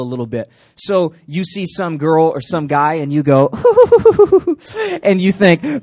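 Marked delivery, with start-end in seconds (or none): 3.5-5.0 high falsetto giggle; girly giggle. Retold or imitated. Pastor mimicking a giggling girl for the youth.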